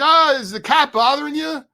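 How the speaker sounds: lightly voiced cheerful host checking in; host calling down to his guest, a mild cheerful lift; friendly host. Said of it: "He's like".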